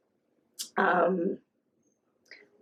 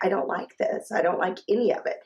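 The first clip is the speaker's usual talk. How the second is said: normal talking voice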